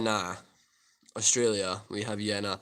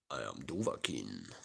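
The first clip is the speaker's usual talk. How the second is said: much lower, quiet hammed-up hero voice; mock epic Dovahkiin line; deep Dragonborn; 13 semitones lower, 13 dB quieter than usual. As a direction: growl it like the Dragonborn. Deep Skyrim meme voice.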